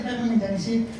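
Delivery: ordinary delivery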